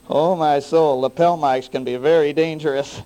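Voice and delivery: loud, high pulpit voice; full-force revival preaching. Real, not acted. Guest preacher holds high loud voice.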